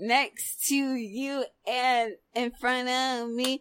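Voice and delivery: slow, lifted hosting voice; slow emcee patter. Pretend. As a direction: present the next rapper slowly, in rhythm. Emcee introducing the next rapper.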